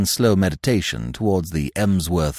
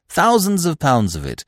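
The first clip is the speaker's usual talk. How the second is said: plain reading voice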